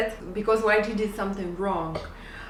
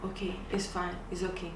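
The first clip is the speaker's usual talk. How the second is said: much softer, grudging "okay, it's fine"; sulky reply; 4 semitones lower, 12 dB quieter than usual. Retold or imitated. Husband sulkily accepting his wife's apology, much softer. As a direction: mutter it like you're still mad. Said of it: "And he's like".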